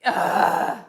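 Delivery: loud, breathy groan of frustration; exasperated ugh. Real, not acted. Mess from the spill.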